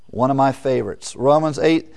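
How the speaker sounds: usual speaking voice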